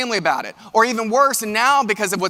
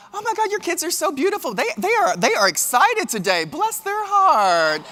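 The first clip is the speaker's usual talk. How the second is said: much higher, syrupy fake compliment; mock sweet Southern lady; 9 semitones higher than usual. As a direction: gush over the kids while insulting them. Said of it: "they're like".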